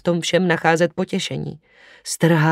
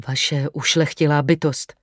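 ordinary delivery

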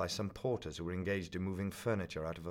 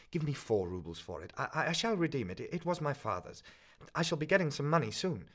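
higher, faster, louder pleading with a stutter; a nervous young man desperate not to be cheated; student begging the pawnbroker for four rubles, anxious stammer; 8 semitones higher, 4 dB louder than usual. Acted.

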